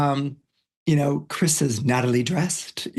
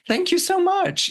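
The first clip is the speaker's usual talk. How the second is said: high-pitched grateful guests; imitated gushing thanks. The family thanks Chris for directions, gushing. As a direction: lift the voice right up in mock gushing gratitude.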